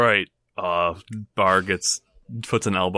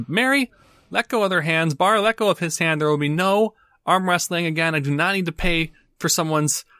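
scolding owner; 8 semitones higher than usual. Acted. Owner scolds staff, stops wrestling.

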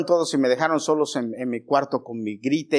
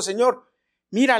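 higher, pleading voice of a sick man; sick man begging Jesus, higher and pleading; 9 semitones higher, 3 dB louder than usual. Acted. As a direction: beg the Lord from a lifetime of waiting. Sick man answers the Lord.

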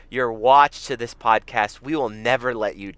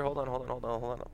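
ordinary talk

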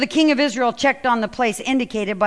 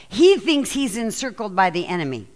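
ordinary delivery

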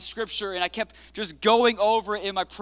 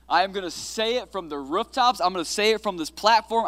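ordinary talk

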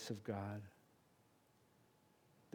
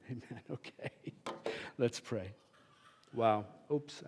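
ordinary talk

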